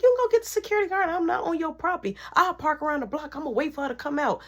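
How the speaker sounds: high, fast, loud defiant girl imitation; mock defiant rant. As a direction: rattle it off fast and high like she's daring you.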